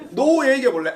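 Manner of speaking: normal talking voice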